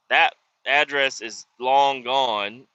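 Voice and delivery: ordinary talk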